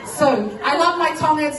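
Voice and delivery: usual speaking voice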